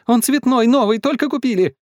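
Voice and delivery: high-pitched pleading protest; child's cry. A narrator's reading. Катя tries to save the new television, crying out. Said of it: "крикнула Катя".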